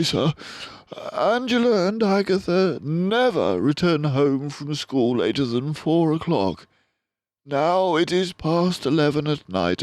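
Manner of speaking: a worried father mouse, held together; strained father. A narrator's reading. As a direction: hold yourself together to explain. Suffering father explains.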